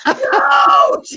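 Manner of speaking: high, loud mock cry of no; hammed-up parents shouting no; mock panicked shout. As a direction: yell to stop him in a comic panic. Parents stopping the diet break.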